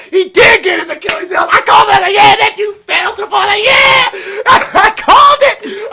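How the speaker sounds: high, loud, half-crying cheer; excited yelling. Real, not acted. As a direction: yell it like your call came true. Recalling calling the kill, hyped.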